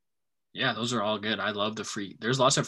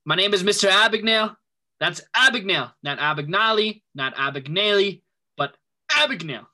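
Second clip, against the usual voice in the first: loud, higher insistent correction; loud mock correction; 8 semitones higher, 9 dB louder than usual. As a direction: insist on the name loudly and higher. Quoting Abagnale loudly.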